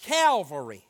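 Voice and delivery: emphatic refrain, hitting each opening word hard; slow, measured pulpit proclamation with ringing repetition; preaching. Real, not acted. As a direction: drive each phrase home, pausing before the payoff.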